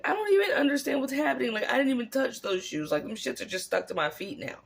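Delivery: fast, high, flustered protest; Dorothy impression. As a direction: rush it out confused and overwhelmed. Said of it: "Dorothy's like".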